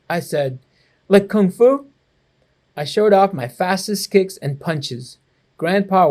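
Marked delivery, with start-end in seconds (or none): 1.1-1.9 higher, louder excited question; eager child. Acted. Boy showing off to grandpa, excited and high. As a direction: bounce up with boyish eagerness.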